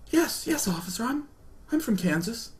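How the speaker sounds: high, soft, stammered answer; quivering timid loser. Retold or imitated. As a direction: answer the officer meekly in mock fear. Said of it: "I become a gigantic quivering loser".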